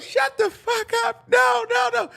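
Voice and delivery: very high mock shriek; hysterical outburst. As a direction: scream it like a distraught mother. Acted mother's distraught outburst while fleeing.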